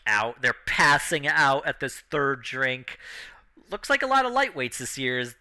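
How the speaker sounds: ordinary delivery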